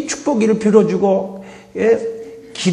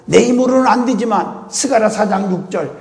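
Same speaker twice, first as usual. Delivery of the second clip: normal talking voice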